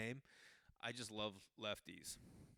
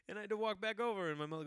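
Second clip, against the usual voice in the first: fast, high and loud; flustered telling; 9 semitones higher, 7 dB louder, faster than usual. Real, not acted. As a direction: rush through it in embarrassment. Kid retreats, mom asks.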